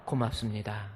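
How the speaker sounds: soft, tender thanks to a wife; warm. Shown as a demonstration. Pastor showing husbands how to speak to their wives, warm and soft. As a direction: say it gently and lovingly. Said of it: "따뜻하게 한번 우리 말해봅시다".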